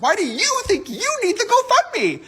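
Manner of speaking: high, slow sneering question; mocking disbelief. Real, not acted. James Charles sneering at a fan's GoFundMe, high and slow.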